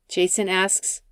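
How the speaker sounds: ordinary delivery, nothing marked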